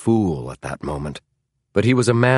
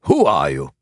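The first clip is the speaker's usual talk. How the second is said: lower-pitched, forceful demand; 4 semitones lower, 4 dB louder than usual. A narrator's reading. Tall man interrogating James, demanding. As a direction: demand it hard and low. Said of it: "demanded the man".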